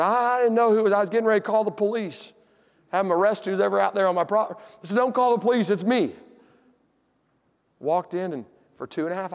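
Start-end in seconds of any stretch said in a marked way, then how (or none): 0.0-4.6 startled, raised voice of the uncle; alarmed uncle. Retold or imitated. Uncle startled by a stranger outside, raised voice. 5.0-6.1 raised voice, calling through. Retold or imitated. Nephew calling out to his uncle not to phone police.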